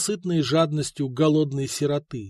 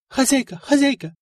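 higher, urgent maid's call; 10 semitones higher, 3 dB louder than usual. A narrator's reading. Maid runs in calling her mistress urgently. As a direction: call the mistress as you run in.

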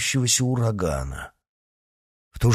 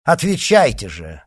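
haughty, louder and higher order; haughty demand; 5 semitones higher, 6 dB louder than usual. A narrator's reading. Leader orders D'Artagnan to answer, haughty. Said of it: "сказал тот же надменный голос".

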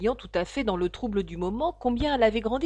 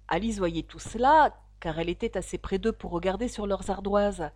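ordinary delivery, nothing marked

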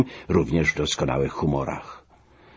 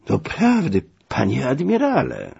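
high-pitched, plaintive complaint; lamenting; 7 semitones higher than usual. A narrator's reading. Aggrieved passenger complaining to the admiral, lamenting. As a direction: bemoan it, voice lifted well up. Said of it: "biadolił Biesiadecki".

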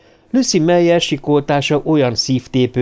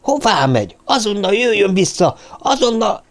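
high, urgent shouted order; raised-pitch alarmed command; yelling after someone; 10 semitones higher than usual. A narrator's reading. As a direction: call her back frantically. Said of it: "ordította Karahan".

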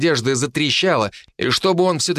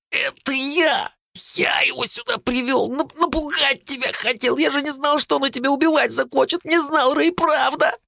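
high, breathless, stammering confession; high teen voice of Phil, scared and pleading; frightened boy confessing; 14 semitones higher than usual. A narrator's reading. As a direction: blurt it all out in panic. Phil confesses while choked.

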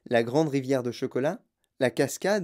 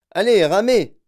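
high, loud yell to the crew; yelled order; 5 semitones higher, 10 dB louder than usual. A narrator's reading. Wonka urging the Oompa Loompas to row, yelling. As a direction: shout it as a command. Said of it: "hurla Monsieur Wonka".

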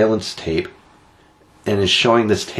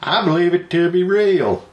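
put-on Southern accent; 8 semitones higher than usual. Quoting a New Hampshire witness to co-host, hammy Southern accent. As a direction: imitate a Southern local on tape. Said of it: "They don't have a Southern accent".

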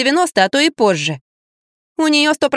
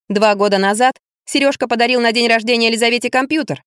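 ordinary delivery, nothing marked

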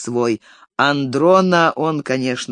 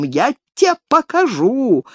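"Я те покажу" higher, slow, "те" stressed; high squealing threat; 6 semitones higher, slower than usual. A narrator's reading. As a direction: screech the threat. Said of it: "визжал он".

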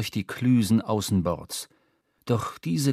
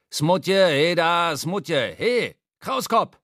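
calling out; 7 semitones higher, much slower than usual. Acted. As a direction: shout for the cook across deck. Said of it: "rief er".

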